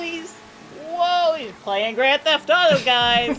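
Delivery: high, loud fake gamer hype; mock excitement. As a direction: hype it up like a kid streamer. Mocking the clip's gamer.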